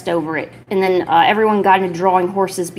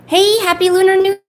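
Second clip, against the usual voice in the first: cheery greeting; 11 semitones higher, 7 dB louder than usual. Real, not acted. Greeting a new chat arrival.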